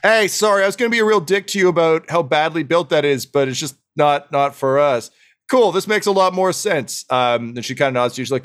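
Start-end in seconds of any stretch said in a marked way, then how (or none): 0.0-7.0 higher-pitched, frank gnome voice; breezily apologetic gnome. Acted. Science gnome apologizes for nearly criticizing the dwarves' building, breezy. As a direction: own up cheerfully, pitched high.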